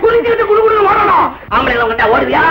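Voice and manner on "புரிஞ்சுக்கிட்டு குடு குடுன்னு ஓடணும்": high-pitched shouted order; angry scolding. Acted. Husband ordering wife away from other men, angry scolding. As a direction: shout it as a furious warning.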